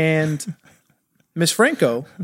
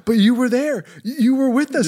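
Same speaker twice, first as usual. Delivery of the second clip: high-pitched, hurt mock protest; betrayed protest; 9 semitones higher than usual. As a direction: plead in disbelief with friends who turned on you. Pleading with friends who betrayed him.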